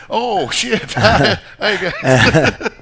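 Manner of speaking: high, loud laughing hello; laughing surprise. Real, not acted. Caught on camera, greeting the guys with a loud laugh.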